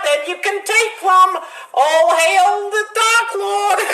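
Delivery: sing-song neighbourly voice gone sinister; a high, cheery Flanders praying to Satan; Ned Flanders voice. Acted. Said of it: "Praying to Satan".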